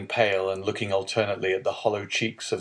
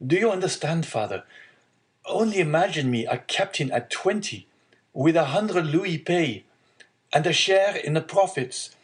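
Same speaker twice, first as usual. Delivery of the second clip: higher-pitched, lightly voiced excited son; eager young sailor; 8 semitones higher, 3 dB louder than usual. Young sailor telling father of his captaincy, lightly voiced eagerness. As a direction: read as a hopeful young man, lifted in pitch.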